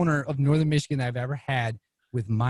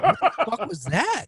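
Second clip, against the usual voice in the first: high, laughing what-the-fuck; baffled laughter through the words; laughing disbelief; 7 semitones higher than usual. Retold or imitated. Retold shock at voicemail.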